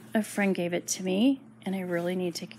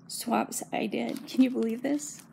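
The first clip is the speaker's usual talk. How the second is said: ordinary talk, nothing marked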